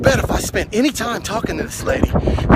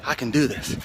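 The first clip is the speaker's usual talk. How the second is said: quiet self-talk; 7 semitones lower, 8 dB quieter than usual. Retold or imitated. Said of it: "I have to keep telling myself".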